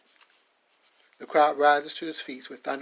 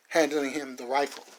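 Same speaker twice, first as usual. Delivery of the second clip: ordinary talk, nothing marked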